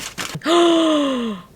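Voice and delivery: long high gasp of delight; amazed gasp. Real, not acted. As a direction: gasp at the sight of it. Seeing the croissant inside.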